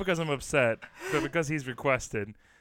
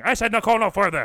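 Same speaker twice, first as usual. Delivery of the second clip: loud, higher proclamation; mock-stern shouted phone answer; firm refusal; 6 semitones higher, 10 dB louder than usual. Shown as a demonstration. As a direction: shut the caller down emphatically. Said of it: "you can always just answer and say".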